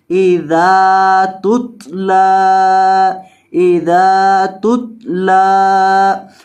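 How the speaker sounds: इज़ा तुतला slow, ता of तुतला kept still, not voweled; drawn-out recitation with ता silent; इज़ा तुतला with a clean sukun on ता; correct reading. Shown as a demonstration. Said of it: "तो इसको पढ़ेंगे".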